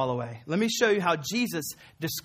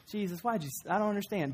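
softer, a lightly voiced confused listener; puzzled disciple; 4 semitones higher, 5 dB quieter, faster than usual. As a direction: play the baffled follower gently. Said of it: "You can just imagine".

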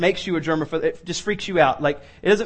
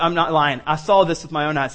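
ordinary talk, nothing marked

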